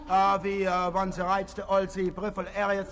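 ordinary talk

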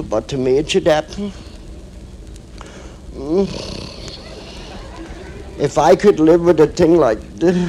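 long hesitant pauses between phrases; halting confession. Acted. Woman confessing to her dearest friend, halting and hesitant. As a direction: struggle to get the confession out.

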